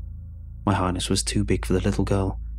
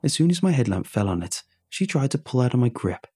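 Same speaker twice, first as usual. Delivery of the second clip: ordinary delivery, nothing marked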